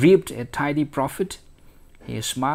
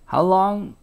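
louder, higher "How long"; pressing question; 6 semitones higher, 5 dB louder than usual. A narrator's reading. Staring customer pressing the shopkeeper.

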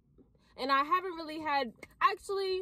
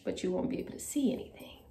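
whisper; 6 semitones lower, much faster than usual. Real, not acted. Changing clothes.

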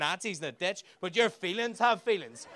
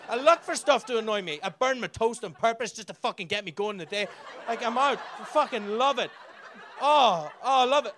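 gleeful rant; 4 semitones higher, 4 dB louder than usual. Real, not acted. Gleeful rant about anger.

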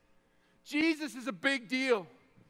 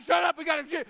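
mock snapping; 5 semitones higher, 7 dB louder, faster than usual. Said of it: "I'm like".

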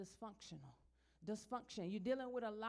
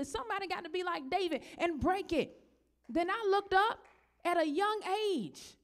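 loud, high, climactic preaching; full-force pulpit climax; 6 semitones higher, 10 dB louder than usual. Acted. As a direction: build the sermon to a shouting peak.

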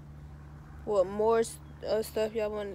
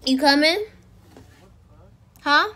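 calling out; 4 semitones higher, 12 dB louder than usual. Real, not acted. Calling to sister from slide.

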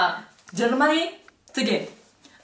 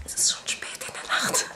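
hushed late-night whisper; 9 dB quieter, faster than usual. Real, not acted. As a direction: breathe it out unvoiced.

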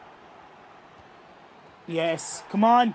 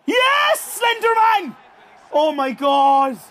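screaming cheer; 8 semitones higher, 7 dB louder, slower than usual. Real, not acted. Keeper saves penalty.

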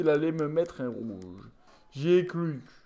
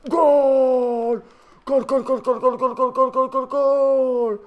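screaming goal over and over, higher and louder; goal celebration shout; 9 semitones higher, 8 dB louder, much slower than usual. Real, not acted. Player screaming goal after his shot goes in, ecstatic shout. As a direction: roar each goal like a commentator.

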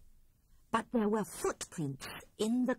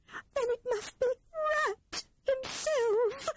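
much higher, slow, tearful little squirrel; sobbing Squirrel; slower than usual. A narrator's reading. Squirrel realises Rat is in her bed and sobs. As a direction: sob it out in fright. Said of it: "sobbed the poor squirrel".

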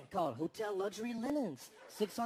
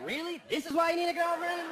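incredulous; 5 semitones higher, 5 dB louder than usual. Retold or imitated. Incredulous retort.

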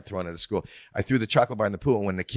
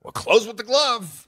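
club owner voice; 12 semitones higher, 4 dB louder than usual. Retold or imitated. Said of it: "He goes".

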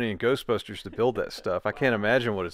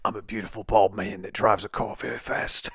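Jason Statham. Pretend. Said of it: "You almost sound like, uh, Jason Statham Goes to Australia".

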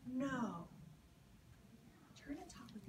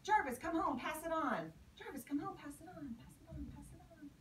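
chain message; 7 semitones higher, 4 dB louder than usual. Retold or imitated. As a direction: pass the message along, fading away. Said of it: "so it says".

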